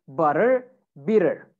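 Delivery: American "better, butter, bitter" with tt flapped to D; American accent. Shown as a demonstration. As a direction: say them the American way, D sound and full R. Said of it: "American accent, you would say".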